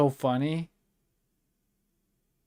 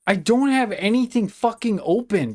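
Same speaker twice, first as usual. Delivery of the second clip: higher and louder, fed-up disbelief; exasperated outburst; 6 semitones higher, 4 dB louder than usual. Real, not acted. Streamer baffled by audio leaking from nowhere, exasperated. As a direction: snap it out in frustration.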